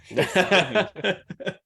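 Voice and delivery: high loud laugh. Real, not acted. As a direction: burst out laughing. Laughs at idea of listening.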